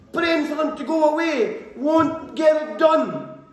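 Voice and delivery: slow, high shouted warning; preacher's shout. Acted. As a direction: hammer the point home at full voice. Preacher shouts his key point.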